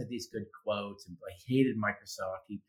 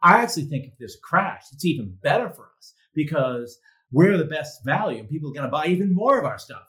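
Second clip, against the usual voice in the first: higher and much louder, brash and sure of himself; cocky CEO boast; 5 semitones higher, 8 dB louder than usual. Retold or imitated. CEO answering the reporter's crash question, brash loud boast. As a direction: sell the crash as a win, full swagger. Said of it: "He's like".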